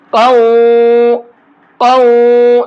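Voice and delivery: قو with the nasal sound merged into و; merged with ghunna. Shown as a demonstration. Teacher demonstrates idgham with ghunna on قو. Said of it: "ساتھ میں غنہ بھی کریں گے".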